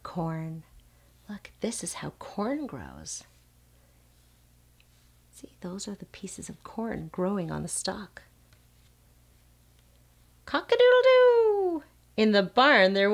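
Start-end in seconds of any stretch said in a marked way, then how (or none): 1.3-8.2 whisper. Real, not acted. Pointing at the picture quietly. 10.4-11.8 high crowing rooster call. Pretend. Reading barn book to child.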